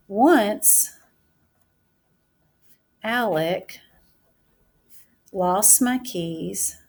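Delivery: drawn out, word by word as she writes; teacher dictating her sentence while writing; slow dictation. Shown as a demonstration. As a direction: say it at writing speed for the class.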